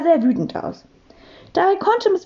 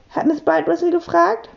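ordinary delivery

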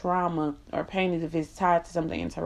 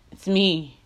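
higher, insistent self-celebration; emphatic bragging; 3 semitones higher, 4 dB louder than usual. Real, not acted. Woman tired of being humble, bragging emphatically. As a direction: hammer each phrase proudly, pitched up. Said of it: "I'm about to brag on me".

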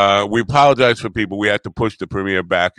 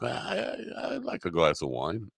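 hushed aside; 13 dB quieter than usual. Retold or imitated. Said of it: "I'm like".

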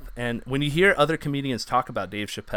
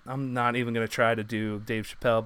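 normal talking voice

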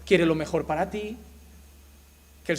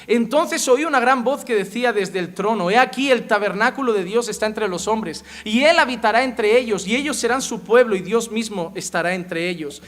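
fervent scripture reading; 3 semitones higher, 7 dB louder than usual. Acted. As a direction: proclaim it louder, building. Reads Revelation aloud. (lectura proclamada de Apocalipsis)

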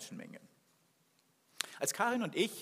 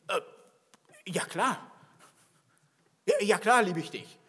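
startled, hurried yes, getting louder; a bit startled; 3 semitones higher, 6 dB louder than usual. Retold or imitated. Peter answering Jesus's question, a bit startled and louder. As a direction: blurt the answer like he was caught off guard. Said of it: "fast so ein bisschen erschrocken".

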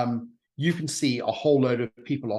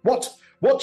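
high, louder outraged double what; indignant what; 11 semitones higher, 6 dB louder than usual. Acted. Razzia reacts to the ultimatum mockery, outraged. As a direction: snap back in disbelief. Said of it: "she says".

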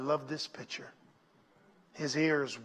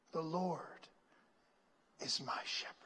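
whispered The Lord is my shepherd; 11 dB quieter than usual. Acted. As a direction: breathe the words softly. Whispered recital.

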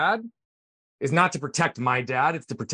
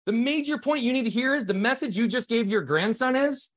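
much higher-pitched, insistent retold rebuke; emphatic confrontation; 9 semitones higher than usual. Son confronting his dad over grandson's treatment, emphatic rebuke. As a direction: drive the point home, voice pitched up.

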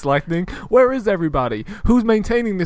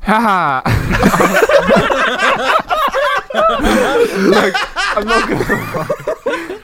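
laughing; 4 dB louder, slower than usual. Real, not acted. Laughing at the mouse voice.

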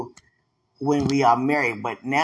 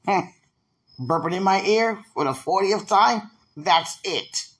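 imitating him; 4 semitones higher than usual. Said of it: "Now he like".

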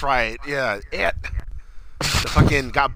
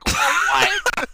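confused crowd; 11 semitones higher, 3 dB louder than usual. Retold or imitated. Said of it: "audience members, too, like".

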